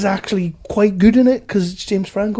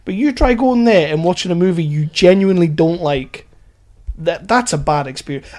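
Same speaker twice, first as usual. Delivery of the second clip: ordinary talk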